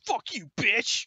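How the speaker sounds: high, loud, drawn-out angry shout; mad outburst. Acted. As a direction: explode in rage as the rejected character. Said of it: "he gets mad and he goes".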